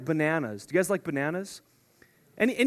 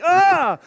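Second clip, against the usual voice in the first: mock choking gasp; 10 semitones higher, 11 dB louder than usual. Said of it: "You're like".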